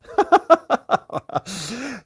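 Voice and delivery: high burst of laughter; hearty laugh. Real, not acted. Host enjoying a commenter's jab, real laughter. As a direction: break into a run of high ha-ha laughs.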